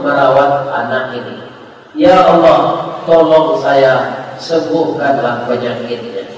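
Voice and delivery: hushed, earnest supplication; pleading prayer. Acted. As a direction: beg quietly for a child's healing. Doctor pleads to God, retold.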